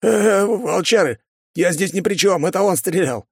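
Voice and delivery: high-pitched, hurried-to-blame plea; panicked excuse. Acted. Guard blames his mate before the wolves, panicky. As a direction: plead innocence in a high voice.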